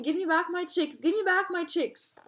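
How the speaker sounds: high, repeated demand; storybook yell. A narrator's reading. Mother ostrich following behind the lioness, high mock yell.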